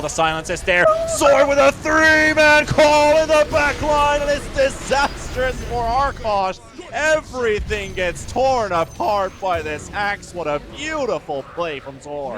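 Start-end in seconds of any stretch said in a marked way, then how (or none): 1.2-12.4 big hyped caster yell; excited play-by-play. Real, not acted. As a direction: shout the big team fight call. Caster hype on team fight.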